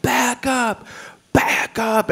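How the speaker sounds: high, sharp mock yell; imitating a yell. Homeless man yells at comedian, high mock yell. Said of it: "yells, like".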